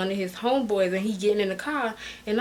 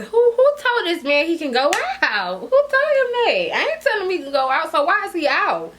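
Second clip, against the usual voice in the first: high, indignant rhetorical questions; mad; 8 semitones higher, 4 dB louder than usual. Retold or imitated. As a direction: demand answers in raised-pitch outrage. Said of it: "I'm mad now".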